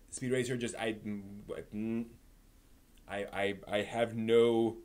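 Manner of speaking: ordinary talk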